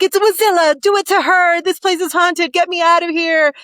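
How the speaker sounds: high, loud, panicky screaming of a terrified guest; panicked scream. Acted. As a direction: shriek it like you're about to flee the haunted room. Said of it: "screaming like a little girl".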